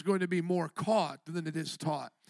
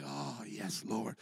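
hushed awed quote; 10 semitones lower, 12 dB quieter, slower than usual. Retold or imitated. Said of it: "I was like".

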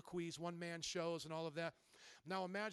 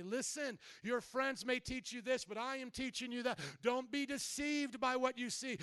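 loud, high, driving sermon delivery; preaching at full force; 5 semitones higher, 6 dB louder than usual. Acted. Preacher urging the congregation to live for Christ, full-force preaching. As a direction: preach it out with rising conviction.

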